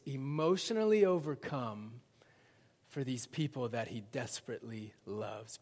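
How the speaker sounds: normal talking voice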